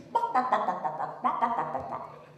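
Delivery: short clipped clucks, fading away; chicken clucking. Retold or imitated. Storyteller imitating yard chickens, clucking. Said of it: "see going around the yard are the chickens".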